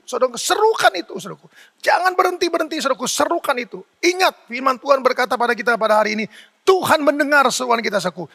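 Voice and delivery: high-pitched, urgent exhortation; impassioned preaching. Real, not acted. Preacher urging the congregation to keep crying out to God, impassioned. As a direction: preach it insistently, pitch lifted on each key phrase.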